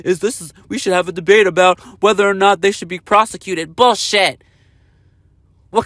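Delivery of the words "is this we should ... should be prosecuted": mocking quote. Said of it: "you're sitting up here talking about".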